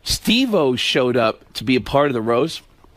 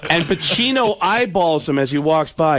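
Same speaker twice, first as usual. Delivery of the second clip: usual speaking voice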